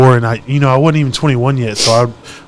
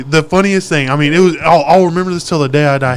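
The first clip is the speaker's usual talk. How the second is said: usual speaking voice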